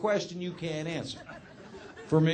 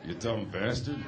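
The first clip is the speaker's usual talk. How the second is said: under his breath; 10 semitones lower, 7 dB quieter, slower than usual. Retold or imitated. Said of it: "under my breath".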